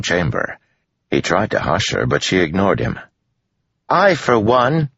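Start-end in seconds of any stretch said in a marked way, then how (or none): 3.9-4.9 put-on high, slow, declaring girl; very loud. A narrator's reading. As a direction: proclaim it slowly with a raised voice. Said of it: "Melody said very loudly".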